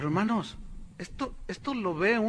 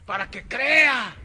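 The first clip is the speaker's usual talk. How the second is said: slow, loud and a bit higher; drawn-out preacher line; 5 semitones higher, 7 dB louder, slower than usual. Retold or imitated. Preacher stretches out his promise, slow and loud. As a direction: stretch it out for effect.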